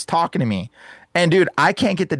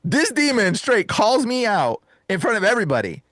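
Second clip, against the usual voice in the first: ordinary delivery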